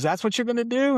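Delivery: teasing sergeant daring the new officer; mocking challenge. E seven mocking the new captain.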